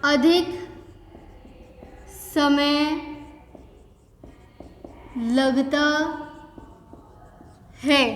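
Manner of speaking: each word spaced out for copying; slow dictation. Shown as a demonstration. Teacher dictating to the class, slow and spaced. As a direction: dictate slowly so students can write. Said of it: "teacher dictates word by word".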